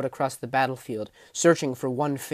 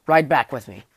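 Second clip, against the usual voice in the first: firm command; 6 dB louder than usual. A narrator's reading. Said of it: "said Hradas".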